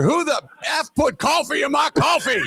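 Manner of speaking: hammed-up yell over the broadcast; retold outraged yell. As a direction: shout it like a furious old broadcaster. Irv yelling on air.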